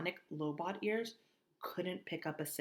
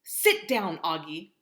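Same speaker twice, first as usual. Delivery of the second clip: firm command; 10 dB louder, slower than usual. Acted. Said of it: "said Mom, grabbing my arm".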